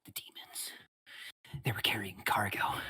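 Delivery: a trembling whisper, fading to breath; a scared, half-mad old man whispering his fear; whispering in terror; frightened hermit. Acted. GM voices shivering hermit.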